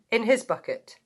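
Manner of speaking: ordinary delivery, nothing marked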